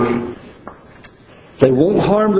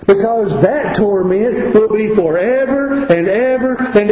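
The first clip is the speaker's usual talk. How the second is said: raised, higher pitched preaching; preaching at full force; 6 semitones higher than usual. Acted. Preacher warns congregation of unending pain, raised preaching. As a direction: press the warning home from the pulpit. Preacher pleads with lost listeners.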